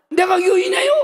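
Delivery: high, loud shocked cry of disbelief; wife yelling in disbelief, eyes wide; shocked shout. Retold or imitated. Wife shouting at her husband, high and loud in disbelief.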